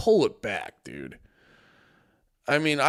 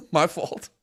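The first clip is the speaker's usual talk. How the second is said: laughing repeat; 3 semitones higher than usual. Pretend. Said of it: "You're like".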